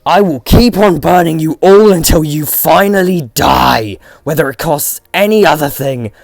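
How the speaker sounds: defiant high furious shout; screaming. Acted. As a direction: scream it at the whole room. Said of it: "screamed into the ruins".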